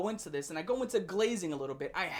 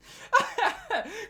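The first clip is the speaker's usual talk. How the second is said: high burst of laughter; 11 semitones higher than usual. Real, not acted. Laughing at tweet.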